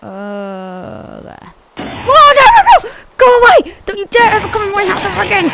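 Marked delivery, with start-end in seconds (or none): 1.8-5.5 high, loud frantic shouting; panicked yelling. Real, not acted. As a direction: scream at an intruder to get out. Yelling at an intruding monster in game.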